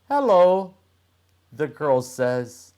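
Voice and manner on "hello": put-on girl's voice. The girl voiced in story.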